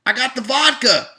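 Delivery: high, loud gleeful announcement; cheery shout. Pretend. Making a smoothie, announcing ingredients loud and high. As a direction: crow it like you found the good stuff.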